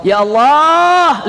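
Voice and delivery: long, high cry to God; high drawn-out exclamation; anguished invocation. Acted. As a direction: draw out a high appeal to God. Prophet cries out seeing the wound.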